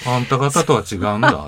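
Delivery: loud, defiant retort in dialect; mock defiant shout. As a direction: fling it back proudly, half in jest. Quoting the "sold your soul" jab.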